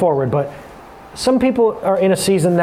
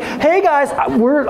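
higher-pitched, lightly mocked carefree dad; mock carefree greeting, pitched up; freewheeling dad; 9 semitones higher, slower than usual. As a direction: imitate a breezy dad's hello. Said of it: "He's Mister Freewheeling Dad".

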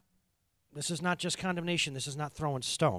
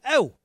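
loud, high frustrated groan; a hammed-up frustrated "oh"; 8 semitones higher, 11 dB louder than usual. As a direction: groan like a stuck father. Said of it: "and he goes".

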